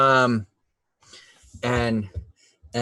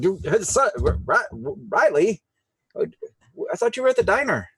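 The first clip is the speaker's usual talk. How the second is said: higher, stammering surprise; startled dad; 8 semitones higher than usual. Acted. Dad startled at his desk by his son, flustered. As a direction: stumble over the words, caught off guard. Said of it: "you startle him".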